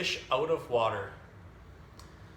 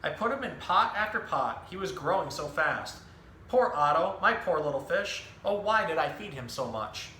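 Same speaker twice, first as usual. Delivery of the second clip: lively picture-book storytelling voice, each line clearly paced; reading to children; 3 semitones higher than usual. Acted. Zookeeper reading a book to children, animated storytelling. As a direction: tell the story to a room of young kids.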